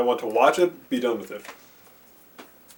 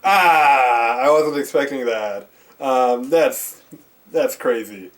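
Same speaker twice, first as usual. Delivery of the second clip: shocked surprise; 6 dB louder, much slower than usual. Retold or imitated. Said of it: "and I was like".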